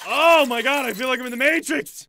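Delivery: high, loud yelling; amazed outburst. Real, not acted. Streamer watching a sped-up movie, amazed outburst. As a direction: shout it out in astonishment.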